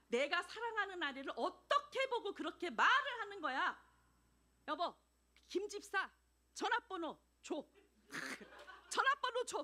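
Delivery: 높고 크게 웃음 섞인 분노; hammed-up furious husband, laughing; mock outrage. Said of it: "진짜 내가 당한 것처럼 화를 내고". (아내를 감싸며 화내는 남편, 과장된 분노)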